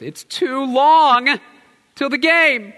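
put-on high, slow, whining child voice; whiny little boy. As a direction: stretch it out like a sulking four-year-old. Four-year-old son voiced.

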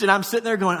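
ordinary delivery

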